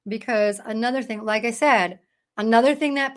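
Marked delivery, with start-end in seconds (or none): none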